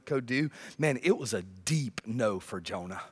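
normal talking voice

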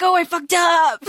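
dismayed oops. Retold or imitated. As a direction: blurt it like you just got caught. Character realizes her mistake.

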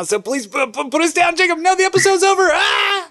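high rising pretend panic; mock panic. Pretends to be lifted up.

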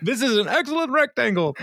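hammed-up high voice, pleased with a gadget; imitating Ron. Ron admiring the iPod, high-pitched mock awe. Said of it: "he's like".

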